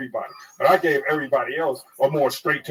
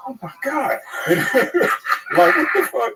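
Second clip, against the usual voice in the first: much higher, laughing disbelief; shocked; 12 semitones higher than usual. Retold or imitated. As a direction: squeal it out in shock. Imitating waitress's shocked reaction.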